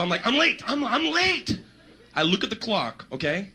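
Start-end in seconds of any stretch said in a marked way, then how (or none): 0.3-1.6 high, alarmed cry on waking; panicked. Acted. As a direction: blurt it out in mock panic. Wakes up in a panic.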